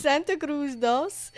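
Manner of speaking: slow, careful Spanish-accented Santa Cruz dos. Shown as a demonstration. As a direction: lean into the Spanish sounds, slowly. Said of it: "but Spanish accent".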